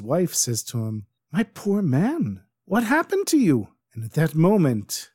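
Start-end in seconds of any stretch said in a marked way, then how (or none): 1.3-3.7 pitying. Acted. Noblewoman pities the innkeeper.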